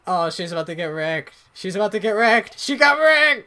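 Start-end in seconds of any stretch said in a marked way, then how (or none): 1.5-3.5 building from talk to a high, loud shout; gleeful escalating gamer hype; mounting excitement. Real, not acted. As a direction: crow louder and higher with each phrase. Player building to a kill in game.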